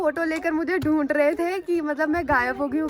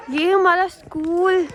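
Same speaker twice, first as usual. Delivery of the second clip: slow, loud, higher announcement of the school; excited reveal; 4 semitones higher, 8 dB louder, much slower than usual. Real, not acted. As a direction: present it like a big reveal. Arriving at childhood school.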